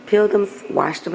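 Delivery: ordinary talk, nothing marked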